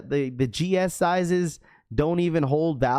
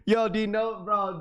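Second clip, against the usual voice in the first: ordinary delivery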